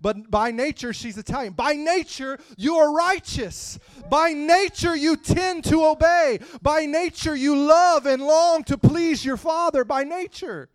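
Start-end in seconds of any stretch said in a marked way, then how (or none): 1.5-10.7 high, loud, slow repeated refrain; full-force preaching. Acted. Preacher declaring the congregation's new nature, loud and high. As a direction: hammer the refrain home.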